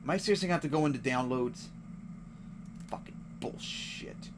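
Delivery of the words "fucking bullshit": slow whispered swearing; muttered curse. Real, not acted. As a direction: grumble it under your breath. Game won't load.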